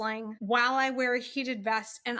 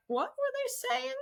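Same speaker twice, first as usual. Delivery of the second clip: slow, high, hushed and baffled; mock-confused mutter; puzzled self-quote; 11 semitones higher, 5 dB quieter, slower than usual. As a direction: play it like you missed the whole point. Pretending to rewatch, confused.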